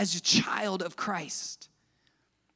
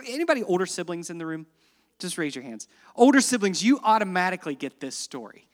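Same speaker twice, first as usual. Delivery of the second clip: usual speaking voice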